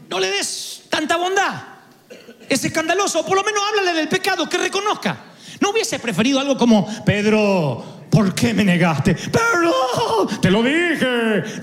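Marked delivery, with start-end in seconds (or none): 0.1-5.2 high, prim outraged voice; mock scandalized. Mocking a scandalized churchgoer. 7.0-11.6 a lightly hammed-up scolding Lord, slow and pleading; mock reproach. Acting the Lord scolding Pedro.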